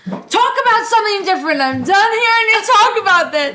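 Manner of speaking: imitating a fed-up counselor, up high; a counselor done in a shrill mock voice. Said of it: "she's like".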